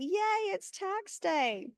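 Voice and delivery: high, mock-bright "yay" dripping with irony; sarcastic cheer. Host mocking tax day to the webinar audience, sarcastic cheer. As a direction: fake celebration, tongue in cheek.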